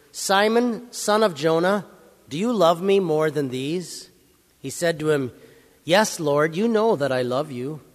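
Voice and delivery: measured, solemn Bible reading, slowing on Jesus' commands; scripture reading. Acted. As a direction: read the Gospel to the congregation, unhurried. Reads Gospel aloud in church.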